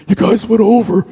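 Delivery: high put-on scolding voice. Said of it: "one of the first things he said was".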